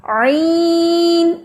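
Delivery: عین drawn slow and pushed into the nose; wrong nasal عین; nasal ain. Shown as a demonstration. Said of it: "ناک میں جانے والی آواز کیسی ہوتی ہے".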